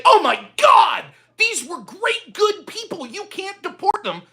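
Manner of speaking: high, sing-song mock of a pleading liberal; mocking whine. Mocking the critics who plead against deportations, high mock whine. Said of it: "that are going to say".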